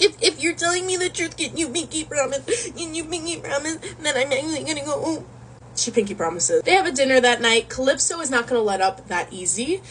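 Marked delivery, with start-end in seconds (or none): 0.0-5.3 high, blubbering plea; mock crying. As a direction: beg through exaggerated fake sobs. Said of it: "he's been crying for seven years".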